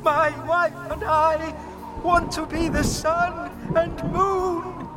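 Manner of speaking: very high, slow yell. A narrator's reading. Frederick to the fish in the storm, high yell. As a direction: yell it over a raging storm. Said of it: "yelled Frederick".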